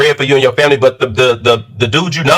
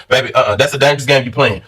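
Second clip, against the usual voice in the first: usual speaking voice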